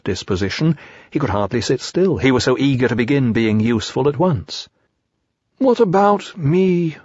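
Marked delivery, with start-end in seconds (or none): 5.6-7.0 higher, slow and forlorn little bear; sad Pooh. A narrator's reading. Pooh asks the friends sadly, higher and slow. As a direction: let it droop like a left-out bear. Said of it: "said Pooh sadly".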